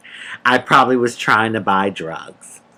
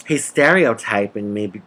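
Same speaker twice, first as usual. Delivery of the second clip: normal talking voice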